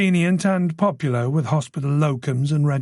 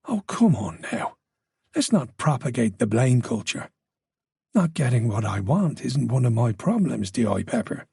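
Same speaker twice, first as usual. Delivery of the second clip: a hint of Irish lilt, confident and low; calm menacing Irish villain, lightly done; Irish accent; 3 semitones lower than usual. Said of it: "the confidence and the accent. An Irish accent".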